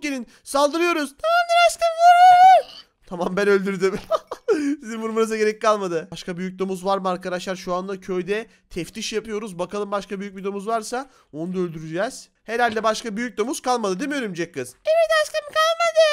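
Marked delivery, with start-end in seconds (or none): 1.2-2.9 put-on squeaky high-pitched spider girl, slow and sweet; girly high spider girl voice. 14.8-16.1 put-on girly high spider girl voice.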